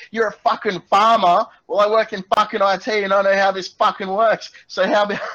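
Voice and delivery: high, loud enraged rant; abusive shouting. Acted. Tech worker abusing the farmer on the phone, shouted rant. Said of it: "at the top of my lungs".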